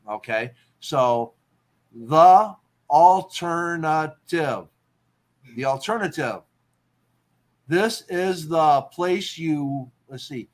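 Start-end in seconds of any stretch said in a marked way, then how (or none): 1.9-4.7 "alternative" very slow, each syllable apart and punched loud. Shown as a demonstration. Teacher counting syllables for a haiku line, "alternative" syllable by syllable. 7.7-9.9 "This is the place you" slow, one beat per word; syllable by syllable. Shown as a demonstration. Composing a haiku line aloud, "This is the place you" syllable by syllable.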